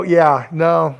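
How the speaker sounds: ordinary talk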